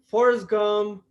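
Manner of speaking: ordinary delivery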